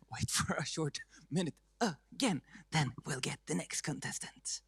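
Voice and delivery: soft whispered aside. Real, not acted. Host quietly tells the audience the next act is coming, whispering. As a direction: let it fall to a whisper.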